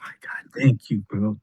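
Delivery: unvoiced relieved whisper; whispered thanks. Real, not acted. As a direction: breathe out grateful thanks. Relieved agreement.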